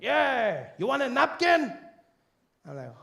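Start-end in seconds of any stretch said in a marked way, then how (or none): 0.0-1.7 high, loud holler; taco truck vendor. Retold or imitated. Taco seller offering the customer a napkin, loud high holler. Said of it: "He's like".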